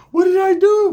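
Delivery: loud, very high mocking imitation; mock innocent protest. Disrespected man wanting saving online, loud high mock protest. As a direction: wail it like a falsely innocent complainer.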